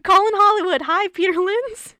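loud, high pretend phone call; mock phone hail. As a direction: shout playfully down an imaginary line.

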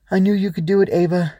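high, loud jubilant cheer; triumphant cry. A narrator's reading. Swoosh cheers as the shadows flee, triumphant. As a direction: cry out proudly in victory. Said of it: "Swoosh cried triumphantly".

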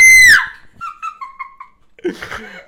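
loud shrieking laugh; screaming laugh. Real, not acted. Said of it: "we scream into the microphone".

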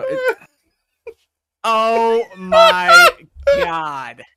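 high, loud shocked cry. Real, not acted. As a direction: recoil at the sign. Shocked opening the sign.

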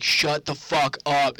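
loud, slow telling-off; mock angry yell. Older brother storming into the bedroom, mock angry.

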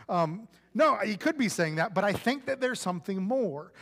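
ordinary delivery

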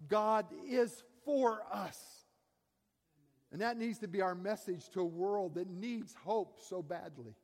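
high, loud fervent preaching; preaching at full force. Acted. Preacher driving home the Bible passage, loud and fervent. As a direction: preach it out to the whole room.

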